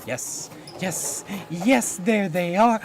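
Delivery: normal talking voice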